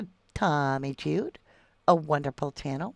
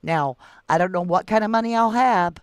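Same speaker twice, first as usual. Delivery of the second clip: louder, insistent promise; emphatic pledge; 2 semitones higher, 6 dB louder than usual. Real, not acted. Mother promising whatever she has for a missing child, emphatic pledge. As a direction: push it out loud, pledging everything.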